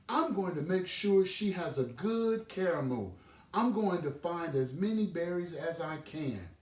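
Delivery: a little one puffed up with purpose; proud Little Rabbit. Acted. Little Rabbit tells Mama Field Mouse his plan, high and proud.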